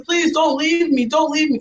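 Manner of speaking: re-enacted anxious begging; pleading. Retold repeated plea to sister.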